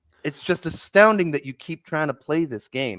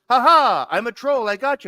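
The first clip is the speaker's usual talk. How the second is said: loud, high Cartman-like crowing; mock gloating; 8 semitones higher, 6 dB louder than usual. Imitating Seer admitting he's a troll, loud mock gloating. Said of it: "And then he goes".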